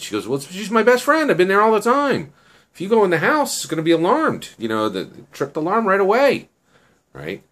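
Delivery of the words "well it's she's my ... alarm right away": high, emphatic retold objection; insistent protest. Girl warning him about her friend's alarm, insistent. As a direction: argue back as the exasperated girl.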